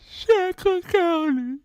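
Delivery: high, slow blubbering answer; mock sob. Son tells mama the hospital, high slow mock sob.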